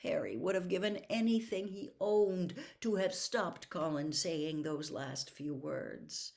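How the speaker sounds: usual speaking voice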